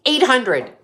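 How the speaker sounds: EIGHT punched high and loud; incredulous echo. Retold or imitated. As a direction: throw the price back in disbelief. Outraged echo of the quoted price.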